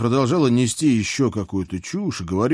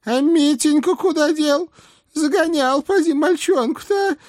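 put-on high nagging old hag; old witch voice; 4 dB louder than usual. A narrator's reading. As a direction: scold like a fussy old witch. Baba Yaga voiced by reader.

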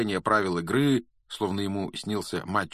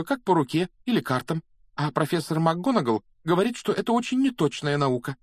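put-on high, earnest girl's voice; reassuring girl; 8 semitones higher, 3 dB louder than usual. A narrator's reading. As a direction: reassure a friend in a higher, insistent voice. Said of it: "нашла для Гарри слова утешения".

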